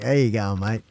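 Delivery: ordinary delivery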